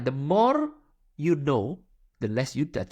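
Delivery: very slow, each word weighed; drawn-out teaching line; slow emphatic motto. Shown as a demonstration. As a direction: repeat the motto slowly for emphasis. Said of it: "jadi intronya".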